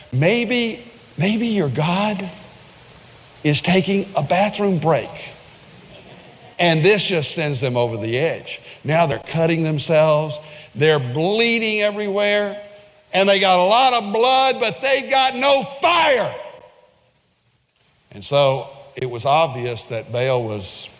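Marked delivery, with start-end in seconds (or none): 0.1-5.1 mocking taunt. He even says. 13.1-16.3 preaching shout. Acted. Preacher peaks.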